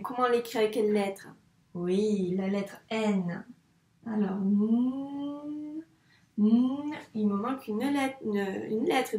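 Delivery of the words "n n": "n" as a very long hum; isolated letter sound. Shown as a demonstration. Teacher shows one sound is still missing, sounded out. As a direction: draw the "n" right out, with no vowel. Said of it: "donc un son".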